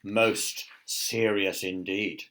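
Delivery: slow, grave soldier voice. A narrator's reading. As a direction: pronounce the charge slowly and solemnly. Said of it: "replied the soldier".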